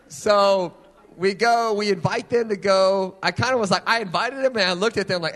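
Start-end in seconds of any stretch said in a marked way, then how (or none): none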